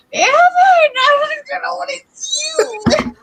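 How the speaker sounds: high, slow, half-sobbing retold cry; mock crying wail. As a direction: replay your teary shout, slow and high. Retold crying after being hit.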